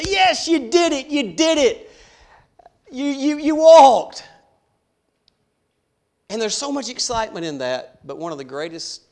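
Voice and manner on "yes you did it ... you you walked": high, loud delighted cheering; joyful cheer. Retold or imitated. Parent cheering a child's first steps, delighted high cheer. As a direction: cheer the toddler into your arms.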